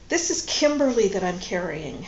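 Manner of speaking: ordinary delivery, nothing marked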